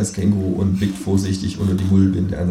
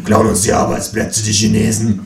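rough, louder grumble; grumbling bigot; 4 dB louder than usual. Acted. Freundlich airing his opinion, rough grumbling voice. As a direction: grouse like a pub bigot. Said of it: "stellt Herr Freundlich seine Meinung".

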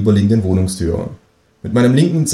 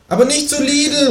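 much higher kangaroo voice calling out; kangaroo calling after. Acted. Kangaroo calling after the narrator, much higher. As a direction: call it after him as the kangaroo. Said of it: "ruft mir das Känguru hinterher".

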